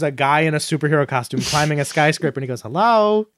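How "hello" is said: high, drawn-out attention-seeking hail; mock calling out. Skyscraper climber to crowd watching band, high mock call. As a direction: call slowly to a distant crowd.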